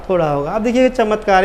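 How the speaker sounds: ordinary talk, nothing marked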